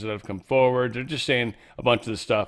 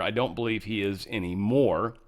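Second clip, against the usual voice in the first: usual speaking voice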